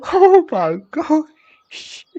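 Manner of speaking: high, slow, stunned gasp; surprised. Real, not acted. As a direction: drag it out in disbelief. Shocked by violent Moogle.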